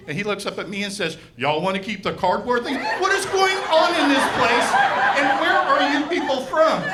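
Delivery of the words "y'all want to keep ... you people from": louder, high Appalachian twang rising in disbelief; Appalachian drawl. Retold or imitated. Box boy baffled at the customer, mock Appalachian accent. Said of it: "Appalachian exchange program".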